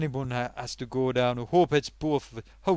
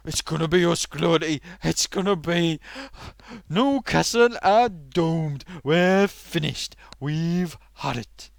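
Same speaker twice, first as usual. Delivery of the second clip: doomed lament; 6 dB louder than usual. Acted. Newcastle fan despairs loudly.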